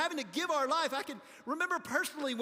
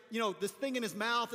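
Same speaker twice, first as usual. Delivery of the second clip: ordinary talk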